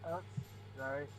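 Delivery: faint, barely audible voice; really quiet. Real, not acted. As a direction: speak very quietly, far from the mic. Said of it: "Hold on, you're really quiet".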